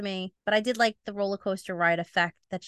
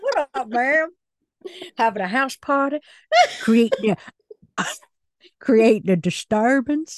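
sheriff voice; 3 semitones higher, 7 dB louder than usual. Pretend. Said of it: "Why am I still doing this voice?".

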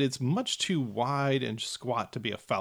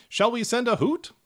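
gnome voice; 10 semitones higher, 4 dB louder than usual. Acted. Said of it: "one gnome turns to the other and says".

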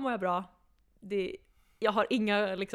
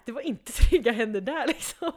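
laughing retort; 3 semitones higher, 10 dB louder than usual. Retold or imitated. Laughing at her own retort.

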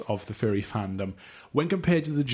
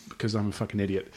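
ordinary delivery

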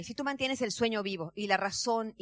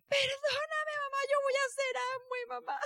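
high, tearful crying plea; sobbing apology; 14 semitones higher than usual. Retold or imitated. Daughter hugging her mother and crying, high and tearful. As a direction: beg forgiveness through sobs. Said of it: "me abraza como niña chiquita sollozando".

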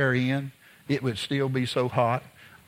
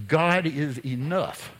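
slow emphatic; slower than usual. Acted. Preacher slows to savor truth.

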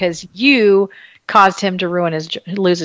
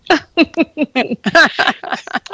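high laugh; 6 semitones higher than usual. Real, not acted. Laughing at own impression.

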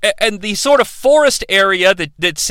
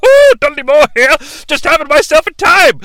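high, loud tipsy giggling British voice; hammed-up sloshed elf; drunk Dudley Moore; 7 semitones higher, 4 dB louder than usual. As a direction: play a merry drunk toasting. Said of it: "And he's like".

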